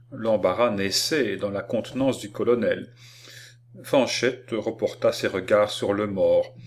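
ordinary delivery

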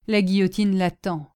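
ordinary delivery, nothing marked

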